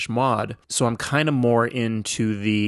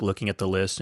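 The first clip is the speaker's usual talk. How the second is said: ordinary delivery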